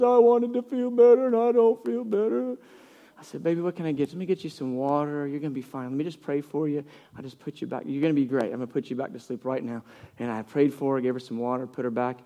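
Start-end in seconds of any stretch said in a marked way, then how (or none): 0.0-2.6 high-pitched, mock-tearful child whine; whiny sick daughter. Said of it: "She goes". 3.4-9.8 soft, reassuring bedside voice; soothing dad. Retold or imitated. Dad soothes his sick daughter.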